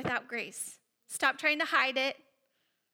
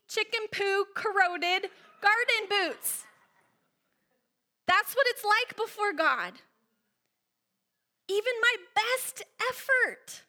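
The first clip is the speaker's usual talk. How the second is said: higher pitched, slow emphatic preaching; impassioned preaching; 6 semitones higher, slower than usual. Acted. Preacher describing sin as muddy boots, impassioned and slow. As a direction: press the image home.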